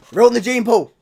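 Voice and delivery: sudden high, loud yell; mock outraged shout. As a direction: bellow it as an appalled ancestor. Forefathers' imagined outraged outburst.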